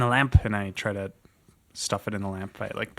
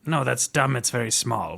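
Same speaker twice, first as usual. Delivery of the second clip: normal talking voice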